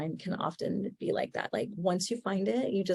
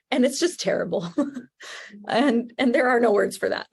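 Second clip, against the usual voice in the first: higher and louder, laughing through it; laughing at the traffic; 4 semitones higher, 6 dB louder than usual. Real, not acted. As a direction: laugh off the misery of the jam. Laughing about awful traffic.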